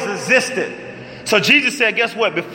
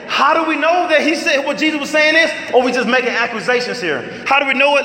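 raised, challenging preacher's question; fiery preaching; 6 semitones higher than usual. Acted. Pastor challenging his listeners, rising preaching voice. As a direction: press the congregation hard.

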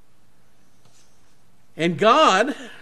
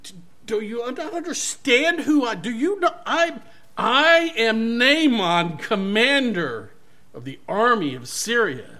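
higher, louder indignant commander ranting; angry; 7 semitones higher, 6 dB louder than usual. Acted. Proud commander raging at being snubbed by the prophet, higher and louder. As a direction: rage with wounded pride, climbing in pitch. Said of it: "pride busting from him".

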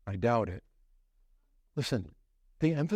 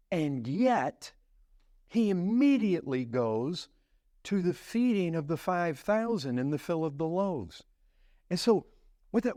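normal talking voice

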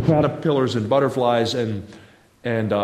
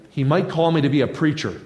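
usual speaking voice

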